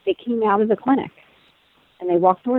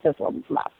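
whispered aside; 8 dB quieter than usual. Retold or imitated. Muttering her reaction walking away.

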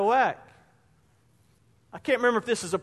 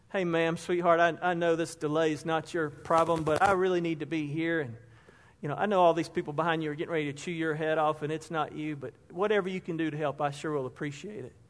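soft, lower, coaxing plea; sweet-talking; 6 semitones lower, 7 dB quieter than usual. Retold or imitated. Traveller sweet-talking a gate agent about a delay, soft and coaxing. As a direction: charm the gate agent gently. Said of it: "how tone can change a situation".

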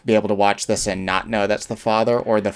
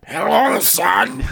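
put-on gravely old man voice; 9 semitones higher, much slower than usual. Voicing Nick Nolte greeting son.

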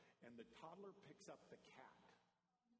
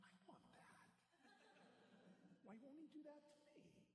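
breathy high mock-whine; high breathy half-joking complaint; jealous whine; 7 dB quieter than usual. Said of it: "I'm like".

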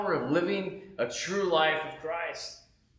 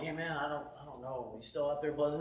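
much softer, unsure, trailing off; hesitant quiet ask; 10 dB quieter than usual. Retold or imitated. Calling Bill, nervously asking about Bible study. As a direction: ask shyly, half hoping for a no.

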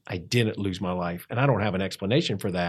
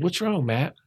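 lightly voiced mentor, pitched higher; Doug; 6 semitones higher than usual. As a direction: imitate a mentor's concerned question, higher. Retold mentor asking after him.

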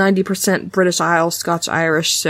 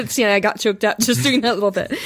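ordinary delivery

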